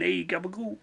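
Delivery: soft mock-Italian aside; Italian accent. Joking about being Italian, in a soft mock-Italian accent. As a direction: toss it off softly in a put-on Italian accent. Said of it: "why do I keep going Italian?".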